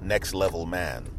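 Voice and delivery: usual speaking voice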